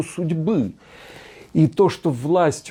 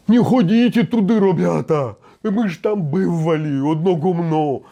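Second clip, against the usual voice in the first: put-on old men shouting; 2 semitones higher than usual. Old men at the gate warning the youngsters, mock shout. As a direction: holler a warning from the bench by the gate. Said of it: "кричали там в деревне".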